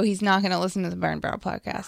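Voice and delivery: soft unvoiced whisper. Real, not acted. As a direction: whisper it like a secret. Secret aside.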